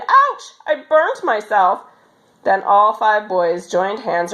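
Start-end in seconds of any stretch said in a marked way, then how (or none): 0.0-1.8 mock cry of pain. A narrator's reading. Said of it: "dropped it instantly and cried".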